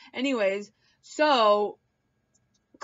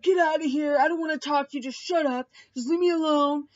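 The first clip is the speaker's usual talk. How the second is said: higher, louder mock angry outburst; mock freak out; 6 semitones higher, 4 dB louder, faster than usual. Josh freaking out at Mark in the bathroom, higher louder mock anger. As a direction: snap at him to go away. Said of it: "He's like".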